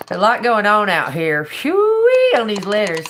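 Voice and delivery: громко, с восторгом, выше; ошеломлённо выкрикнуть; loud exclamation. Real, not acted. Amazed at the letters.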